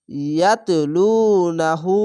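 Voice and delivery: ইয়াতলুনাহু slow, লু and হু held; teacher modelling the long u; stretched vowel. Shown as a demonstration. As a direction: draw out ইয়াতলুনাহু evenly. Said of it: "উল্টা পেশে এক আলিফ টেনে পড়বেন".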